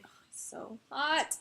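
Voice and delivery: breathy whispered swoon; dreamy whispered crush. Real, not acted. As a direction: whisper it like a smitten fan. Gushing over a hot idol.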